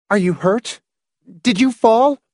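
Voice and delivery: high, anxious questioning; anxious wife's voice; worried questions. A narrator's reading. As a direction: ask in alarm, pitch climbing. Worried wife questions husband.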